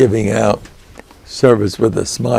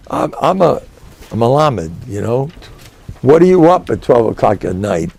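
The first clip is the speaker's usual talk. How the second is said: ordinary delivery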